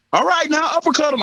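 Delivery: high, loud corner shout; coach yelling. Retold or imitated. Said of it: "I heard his coach yell".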